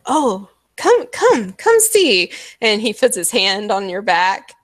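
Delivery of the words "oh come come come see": high, loud, slow luring call; coaxing showman. Acted. To the cat woman, a high coaxing showman invitation. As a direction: lure her in, high and sweet. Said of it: "He's like".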